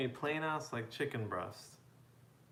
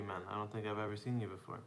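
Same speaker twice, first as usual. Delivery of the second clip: ordinary talk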